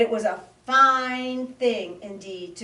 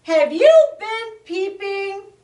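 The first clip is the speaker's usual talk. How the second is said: shouting; 13 semitones higher, 10 dB louder than usual. Acted. Possum shouts to muskrat over the peeping. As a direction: call out over the noise. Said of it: "shouted Possum".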